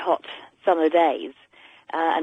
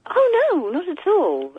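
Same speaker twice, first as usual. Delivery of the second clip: shocked protest; 11 semitones higher, slower than usual. Real, not acted. Protests cat sleeping outside.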